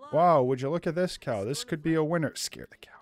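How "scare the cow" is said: whisper. Real, not acted. Whispered plan.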